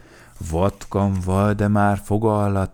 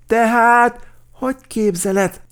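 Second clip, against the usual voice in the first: very high, loud objection; sharp raised cutting-in; indignant interruption; 10 dB louder than usual. A narrator's reading. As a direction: burst in with outraged disbelief. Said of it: "szakította félbe Mikka Makka".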